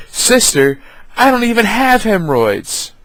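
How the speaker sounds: a hammed-up high-pitched protest; imitating uncle's retort. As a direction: squeak it out as the indignant uncle. Uncle's deadpan retort in car.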